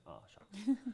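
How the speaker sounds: a high, hammed-up oh shucks; mock disappointment. Told the niece is off till Tuesday, mock letdown. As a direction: play at being let down.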